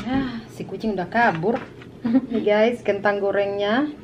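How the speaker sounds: ordinary delivery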